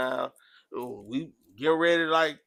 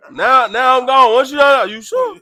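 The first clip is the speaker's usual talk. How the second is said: loud, high-pitched challenge; retold fight talk; 7 semitones higher, 12 dB louder than usual. Man replays squaring up for a fight, loud challenge. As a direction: raise voice and pitch, replaying a standoff.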